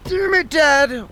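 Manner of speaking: high, drawn-out mock yell; exasperated outburst. Son reacts to dad's April Fool's prank, exasperated. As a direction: whine it slowly in high-pitched outrage.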